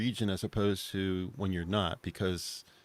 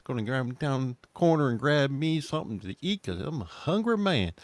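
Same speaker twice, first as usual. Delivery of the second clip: put-on Southern accent; 4 semitones higher than usual. Imitating someone down south getting food, Southern accent. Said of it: "someone down south".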